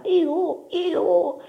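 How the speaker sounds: very high, drawn-out "ee-oo"; owl hoot. A narrator's reading. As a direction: imitate a night owl. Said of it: "la civetta che cantava". (verso acuto della civetta)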